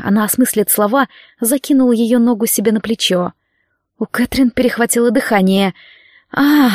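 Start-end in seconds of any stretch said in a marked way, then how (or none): 6.3-6.7 high breathy exhale; sharp high gasp. A narrator's reading. Catherine gasping at the demon's touch, high exhale. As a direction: let the gasp escape. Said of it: "выдохнула она".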